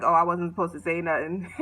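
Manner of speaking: laughing through a low guilty admission; sheepish laugh. Retold or imitated. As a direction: giggle it out, caught. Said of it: "He was like".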